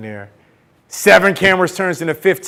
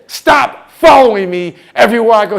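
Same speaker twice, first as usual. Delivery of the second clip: loud, higher, acted exasperation; fed-up telling-off; 5 semitones higher, 8 dB louder than usual. Nervous woman telling her pursuer off, loudly fed up. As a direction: warn him off, loud and emphatic.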